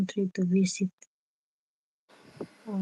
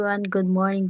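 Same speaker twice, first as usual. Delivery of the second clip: normal talking voice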